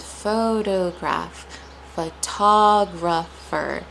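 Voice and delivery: photograph, photographer pulled apart slowly; broken up slowly. Shown as a demonstration. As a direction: break photograph, photographer into slow syllables. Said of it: "sometimes breaking it up".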